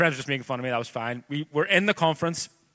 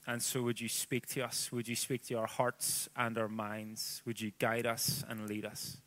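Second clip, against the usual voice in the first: prayer; 3 semitones lower, 11 dB quieter than usual. Acted. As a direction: pray it quietly over the room. Leads the congregation in prayer.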